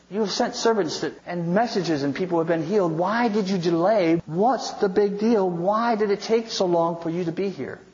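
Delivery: high, pressing string of questions; exasperated reproach. Acted. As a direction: demand answers, each question hit hard. Martha's reproach to Jesus, acted.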